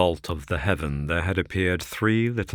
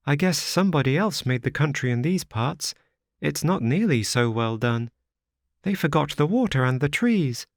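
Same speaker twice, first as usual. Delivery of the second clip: put-on high, childlike girl's voice; little girl; 10 semitones higher, 3 dB louder than usual. A narrator's reading. Little girl asks the wanderer if they will die, high childlike voice. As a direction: play a small frightened child asking.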